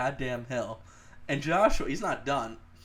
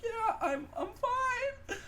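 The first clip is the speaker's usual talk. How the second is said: high, teary mock sob on fine; mock weepy; much slower than usual. Beaten kid tells teacher he's fine, in a high mock sob. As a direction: imitate his teenage self crying and insisting he's fine. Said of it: "I'm weeping".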